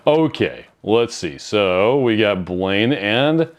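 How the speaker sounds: normal talking voice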